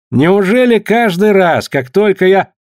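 high, drawn-out exasperated complaint; acted exasperation, stretched words. A narrator's reading. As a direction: complain in exasperation, pitch lifted. Collins exasperated, complaining to Lik.

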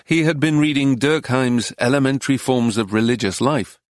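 ordinary delivery, nothing marked

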